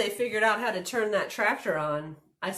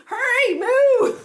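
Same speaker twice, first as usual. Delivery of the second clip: high, loud plea to hurry; frantic storybook shout; urgent cry; 11 dB louder, slower than usual. A narrator's reading. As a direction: cry out to a friend in a panic. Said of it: "cried Minnie".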